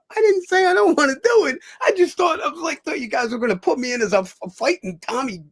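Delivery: high, loud shouted protest; shouting protest that settles down by the end; retold shouted protest. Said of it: "I go".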